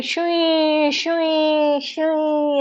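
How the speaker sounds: drawn-out "shui" swoosh, repeated; swooshing swing noise. Shown as a demonstration. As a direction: mimic a swing sweeping back and forth. Imitates the swing ride's swoosh.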